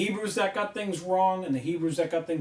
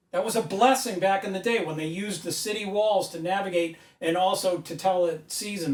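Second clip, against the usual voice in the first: usual speaking voice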